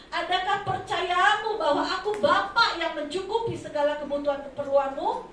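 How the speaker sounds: loud, emphatic preaching; fervent preaching. Acted. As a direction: press the point home with force.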